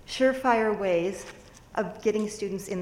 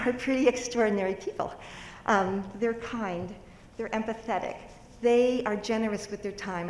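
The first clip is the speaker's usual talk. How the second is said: ordinary talk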